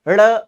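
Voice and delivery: ड़ alone, tongue flicked down; isolated flap sound. Shown as a demonstration. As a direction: sound out the flapped ड़. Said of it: "जीभ ऊपर गई और फिर तुरंत नीचे आई है". (ड़ को अलग से, जीभ झटके से नीचे)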